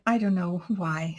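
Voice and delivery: ordinary talk, nothing marked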